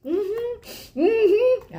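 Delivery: put-on high, vague mm-hmm of a kid; humming along without understanding; mock agreeing kid. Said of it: "And he's like".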